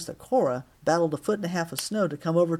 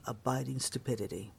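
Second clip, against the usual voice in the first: ordinary delivery, nothing marked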